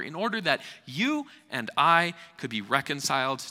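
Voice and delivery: high, loud, fast sermon climax; fiery preaching. Acted. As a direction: proclaim it with full force. Preaching at full force.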